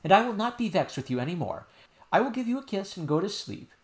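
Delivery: put-on high child voice, pleading; a little girl explaining earnestly; insistent princess. Princess telling nurse what happened, high and earnest.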